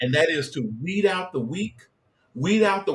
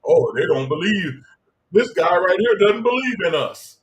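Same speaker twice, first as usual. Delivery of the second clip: mocking the spirits; 4 semitones higher, 5 dB louder than usual. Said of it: "I doubt they care".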